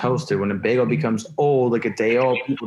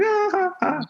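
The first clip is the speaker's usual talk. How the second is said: high mock hysterical laugh, pitched way up; a squeaky hysterical cackle, imitated; imitating mom's laugh. Son mimicking his mother's hysterical laugh in a high voice. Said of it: "and laughs hysterically".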